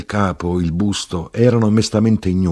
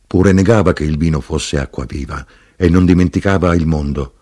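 ordinary delivery

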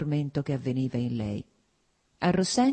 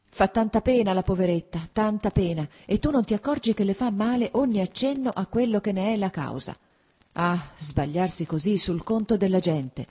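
put-on higher, pained plea for the poor girl; pitying lament; 5 semitones higher than usual. A narrator's reading. Mother grieving over her daughter, high and pained. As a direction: mourn how wrong they were. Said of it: "dal cambiamento di tono".

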